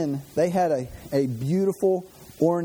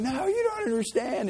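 pleading insistence; 8 semitones higher than usual. Retold or imitated. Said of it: "And it's like".

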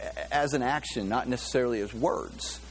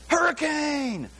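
shouted warning; 14 semitones higher, slower than usual. Retold or imitated. College student yells to distract the firing squad, high shout. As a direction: yell it to spark a panic. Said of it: "He goes".

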